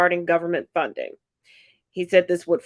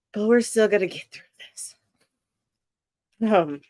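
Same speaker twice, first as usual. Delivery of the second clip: normal talking voice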